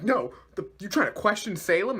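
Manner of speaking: high, accusing retort; indignant challenge. Retold or imitated. Henchman challenging a colleague for questioning Salem, indignant. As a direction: act out an affronted henchman. Retold villain argument.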